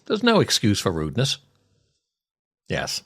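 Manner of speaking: ordinary talk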